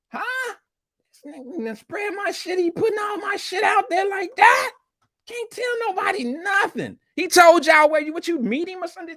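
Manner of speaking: high, shrill disbelieving outcry; shocked outburst. Real, not acted. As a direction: react in stunned disbelief. Shocked reaction to on-air reveal.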